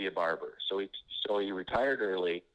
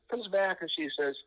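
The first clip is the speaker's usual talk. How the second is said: ordinary talk, nothing marked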